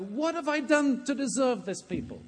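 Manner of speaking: loud, high-pitched anguished protest to God; impassioned, exasperated lament at full voice; Moses crying out. Acted. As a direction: cry out to heaven, utterly fed up. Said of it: "cries out to God".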